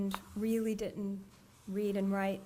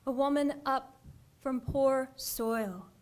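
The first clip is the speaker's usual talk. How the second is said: normal talking voice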